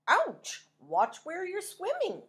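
high, loud grumpy yelp; grumbling pufferfish. Acted. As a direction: grumble like a prickly fish. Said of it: "grumbled a voice".

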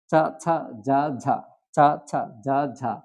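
চা, ছা, যা, ঝা with an open a vowel; Pali consonant drill. Shown as a demonstration. Teacher showing the second row in Pali, open a vowel on each. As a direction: say চ as চা, ছ as ছা, each distinct. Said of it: "অকার যুক্ত হচ্ছে".

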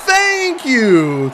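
loud thank you. Real, not acted. Fan shouting at the no-penalty call, vindicated shout. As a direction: shout it like you were proven right.

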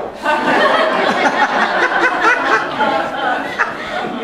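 high breathy burst of laughter; real laugh. Real, not acted. Preacher laughing at his own story, a high breathy laugh.

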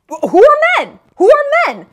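veel hoger en luider herhaald; mocking high repeat. Real, not acted. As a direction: herhaal het spottend hoog en luid.

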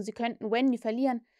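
usual speaking voice

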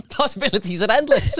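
high, playful sponsor plug; lightly hammed ad read, pitched well up; mock ad voice. Plugging the sponsor's blue tops in a mock ad voice.

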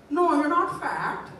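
bright mock mother answering her son; mom's voice. Mom voiced in a joke.

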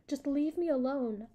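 loud, high yell; a loud, high cry to be left alone. A narrator's reading. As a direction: shout it through the locked door. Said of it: "I yelled".

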